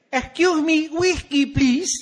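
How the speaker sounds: high, loud, affected bar order; hammed-up sophisticated falsetto; rehearsed drink order. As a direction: order in a very high, put-on polite voice. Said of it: "how to order a drink".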